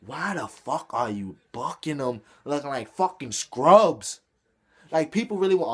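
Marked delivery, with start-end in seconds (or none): none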